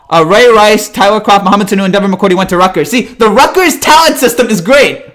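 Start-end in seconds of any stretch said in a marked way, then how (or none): 2.9-5.0 sarcastic laugh. Real, not acted. Sarcastic praise while laughing.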